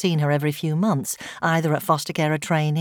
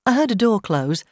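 plain reading voice

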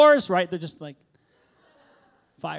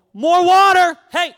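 loud, high call for more water; shouted demand; 14 semitones higher, 15 dB louder, slower than usual. Acted. Elijah shouting at servants for water, loud. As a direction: yell it across the yard.

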